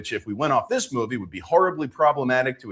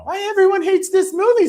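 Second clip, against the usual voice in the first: squeaky mock commenter; 6 dB louder than usual. Reading comment in put-on voice.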